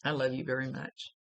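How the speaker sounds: ordinary talk, nothing marked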